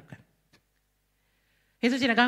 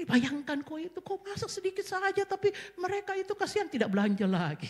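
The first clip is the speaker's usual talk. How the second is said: amused laugh; 10 semitones higher, 4 dB quieter than usual. Real, not acted. Pastor laughing about her son.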